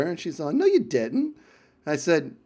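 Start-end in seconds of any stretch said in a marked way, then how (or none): 0.5-1.4 higher, playful Essex "No, you didn't"; light Essex accent impression; mock Essex accent. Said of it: "she's from Essex".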